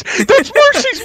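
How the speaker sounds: Mercy's dragged out high and slow; stretched excited shout. Real, not acted. Recognizes famous streamer, thrilled.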